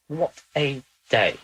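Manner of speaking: slow, drawn-out "What a day"; slow weary repeat. Real, not acted. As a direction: stretch "What a day" out slowly, tired. Weary sigh after session.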